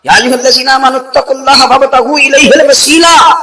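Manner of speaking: loud, high ringing recitation; Quranic recitation. Acted. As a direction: proclaim the verse to the congregation. Quran verse recited in sermon. (بلند آواز میں تلاوت)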